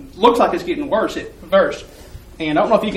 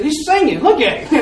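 laughing; 7 semitones higher than usual. Retold or imitated. Coworker laughing at singing man.

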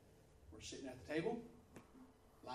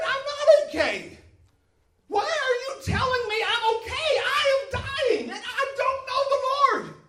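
high, loud panicked plea; desperate cry; 10 semitones higher, 10 dB louder than usual. Retold or imitated. Dying woman pleads with her pastor, high frantic cry.

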